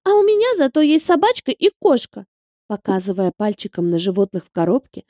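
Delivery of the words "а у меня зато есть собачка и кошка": put-on high, cutting child's retort; a small girl winning the argument; snappy little girl. A narrator's reading. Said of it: "отрезала".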